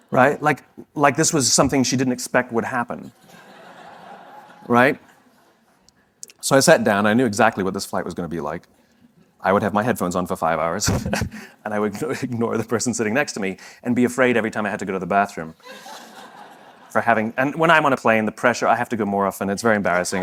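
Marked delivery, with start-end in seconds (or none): 0.1-0.4 begrudging sigh. Retold or imitated. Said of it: "begrudgingly moved her leg ... and went". 10.9-20.2 laughing. Real, not acted. Laughing through the bathroom confession.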